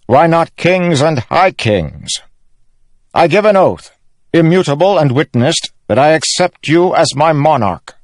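high, ringing oath-swearing; loud, measured ceremonial declaration. A narrator's reading. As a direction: proclaim a solemn vow before witnesses. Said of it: "Elhokar said in a loud voice".

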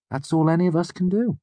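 lightly voiced Bast. A narrator's reading. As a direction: pitch it up, easy and friendly. Bast's voice.

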